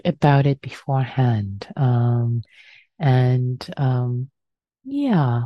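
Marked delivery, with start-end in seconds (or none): none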